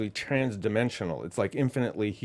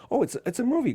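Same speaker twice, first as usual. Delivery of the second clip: light high voice, answering grandma; piping up brightly about the movie; high-pitched reply; 13 semitones higher, 3 dB louder, faster than usual. Retold or imitated.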